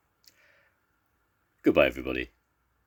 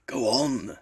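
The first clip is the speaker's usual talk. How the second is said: higher-pitched husky urging; husky lion; 8 semitones higher than usual. A narrator's reading. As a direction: urge huskily, as with a sore throat. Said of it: "said the lion huskily to Basil".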